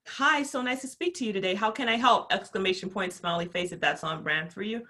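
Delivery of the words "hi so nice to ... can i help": cheerful chat greeting. Retold or imitated. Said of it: "and you're like".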